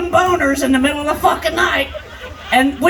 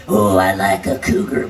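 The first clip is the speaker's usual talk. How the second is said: mock young guy. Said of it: "Young boys like".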